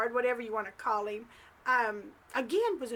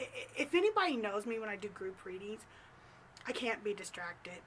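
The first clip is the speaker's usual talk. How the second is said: usual speaking voice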